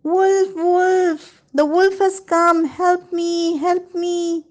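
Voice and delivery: high, loud fake cry for help; shouting aloud. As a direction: yell it out like a prankster calling wolf. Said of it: "he started shouting aloud".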